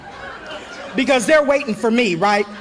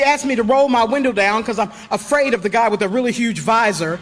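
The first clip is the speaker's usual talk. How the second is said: ordinary talk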